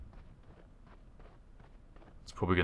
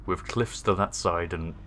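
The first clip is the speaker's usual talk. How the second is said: ordinary delivery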